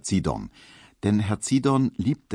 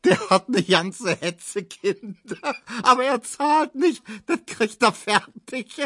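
very high, squealing laughter-choked speech; roaring with laughter; 12 semitones higher than usual. A narrator's reading. Neumann mocking Kubinke, roaring with laughter. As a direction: gasp the jibe out between laughs. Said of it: "Neumann lachte".

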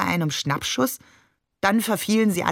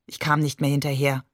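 ordinary delivery

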